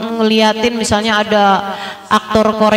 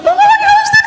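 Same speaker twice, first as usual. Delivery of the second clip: much higher, mock excited squeal; squealing fan girl; 3 dB louder than usual. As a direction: imitate a teen girl screaming over a star. Screaming fangirl voiced. (menirukan remaja histeris, suara sangat tinggi)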